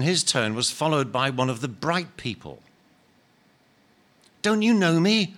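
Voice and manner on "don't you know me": loud, high joyful call; shouted greeting. Acted. Bright spirit shouting to the ghost in greeting. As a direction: call out to an old friend. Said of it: "he shouted to the ghost".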